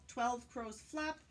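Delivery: usual speaking voice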